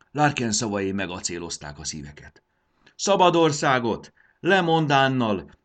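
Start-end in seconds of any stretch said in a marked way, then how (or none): 2.9-5.5 loud, high crowd chant, drawn out; rallying shouts. A narrator's reading. Rebels cheer their leader's call to revolt, shouting. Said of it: "kiabálták mindenfelé".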